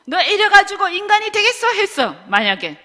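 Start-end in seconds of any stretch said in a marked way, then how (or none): 0.1-1.7 higher, louder scolding with a rising 되겠어; 야단치듯 높게 다그치기; 화난 선생님. Retold or imitated. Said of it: "화가 나가지고 선생님이". (화가 난 선생님이 학생을 꾸짖는 높은 목소리)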